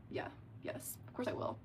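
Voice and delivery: very soft, almost whispered yes; subdued agreement. Retold or imitated. Date agreeing to cover the bill, subdued. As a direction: give in under your breath. Giving in to paying for him.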